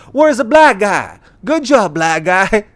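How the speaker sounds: high, loud, mock-enthusiastic call; mock hearty stranger. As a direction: bellow it like a clueless fan.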